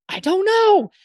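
high, loud comic protest; mock loud child. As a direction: play the middle child yelling to be heard. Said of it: "Why are you speaking so loud".

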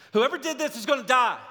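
loud, fast furious threat; a hammed-up royal threat; angry king's threat. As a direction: bark it like a rash tyrant. Preacher voices irate Saul.

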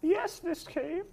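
put-on high, slow old woman; a frail granny, drawn out; old lady voice. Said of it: "old lady voice".

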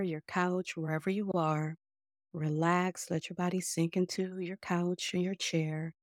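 calm breathing cues, unhurried and hushed; guided meditation. Acted. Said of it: "enjoy this mindful guided meditation".

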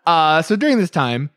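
ordinary talk

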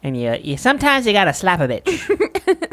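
slow comedian impression with a laugh; Cat Williams voice. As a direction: drag it out slow like a stand-up bit. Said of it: "That's Cat Williams' voice".

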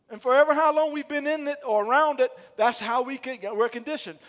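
loud, driving preacher cadence; preaching at full force. Acted. Pastor urging the congregation, loud preaching. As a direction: pound the point home to the church.

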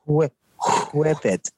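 practising "whip" with a puffed HW; long breathy onset on "whip"; attempted airy wh. Shown as a demonstration. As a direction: blow out, then say "whip". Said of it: "I can't pronounce it like you guys".

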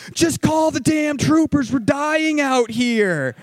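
high, loud retold yell, slow at the end; desperate yell. Scott yelling at his wife on the phone, desperate. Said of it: "Scott ends the phone call with".

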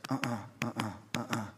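low, soft double thumps; thudding heartbeat noise in the throat; imitated heartbeat. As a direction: mimic a pounding heart with short, quiet grunts. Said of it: "My heart starts".

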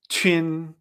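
"chun" as chüin, ch before ü-in; Q pronunciation. Shown as a demonstration. Teacher modelling Q plus the final, "chun" as chüin. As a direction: say "chun" with rounded ü gliding to in. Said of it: "con la Q".

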